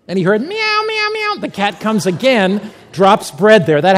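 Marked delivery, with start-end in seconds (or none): 0.4-1.3 very high-pitched imitated meows; cat meow. Preacher voicing the cat in a prison story, high meowing. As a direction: mimic a cat's repeated meow.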